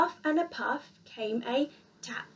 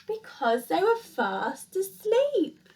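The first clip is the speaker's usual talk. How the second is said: sleepy ending; 6 semitones higher, slower than usual. A narrator's reading. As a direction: ease them off to sleep. Closing bedtime story line.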